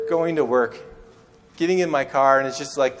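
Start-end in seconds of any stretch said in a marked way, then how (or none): none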